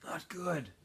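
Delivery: very soft, weak reply; faint, exhausted answer; said quietly. Acted. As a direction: barely voice it. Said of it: "said quietly".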